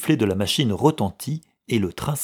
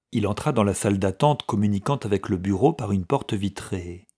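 ordinary delivery

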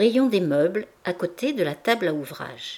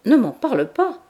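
loud, higher-pitched outcry; exclaiming; 5 semitones higher, 6 dB louder than usual. A narrator's reading. Mother cries out to her old school friend, exclaiming. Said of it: "s'écria madame Bourdelais".

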